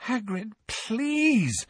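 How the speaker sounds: high, very slow pleading; desperate. A narrator's reading. Hermione begging Hagrid, slow desperate high plea. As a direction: beg him, nearly despairing. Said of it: "a note of real desperation".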